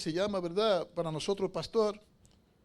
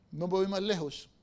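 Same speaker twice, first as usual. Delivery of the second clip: normal talking voice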